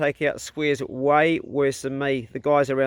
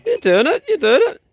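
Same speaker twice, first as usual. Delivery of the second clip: cheering on; 7 dB louder than usual. Real, not acted. Cheering the boy chopping wood.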